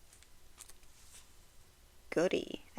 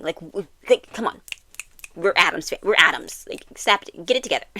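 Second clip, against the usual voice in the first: impatient scolding; 2 semitones higher, 8 dB louder than usual. Retold or imitated. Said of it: "But she's like".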